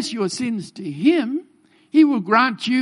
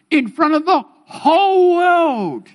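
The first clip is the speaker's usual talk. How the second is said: higher, louder and slower, dragging out whole world; dramatic emphasis; 7 semitones higher, 4 dB louder than usual. Acted. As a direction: build it up like a looming disaster. Dread of God shaming you publicly.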